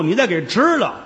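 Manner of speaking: normal talking voice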